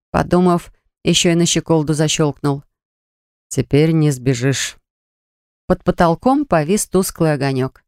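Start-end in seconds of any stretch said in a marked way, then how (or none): 3.5-4.8 slow, low teasing male voice. A narrator's reading. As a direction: savor it slowly. Xavier locks the door.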